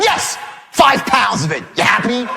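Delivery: explosive high yes, then curt jabs; retold irate comeback; pissed-off sarcasm. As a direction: throw a spiteful confession at the caller. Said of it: "I'm so pissed".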